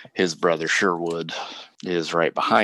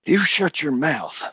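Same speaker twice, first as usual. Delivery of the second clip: furious demand; 8 semitones higher, faster than usual. Acted. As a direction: demand an answer, seething. Cornelius enraged at Bill.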